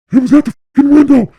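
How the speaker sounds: high, loud, fast panicked yelling; freaking out. Retold or imitated. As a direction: yell it like you just shot at something. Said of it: "Just freaking out".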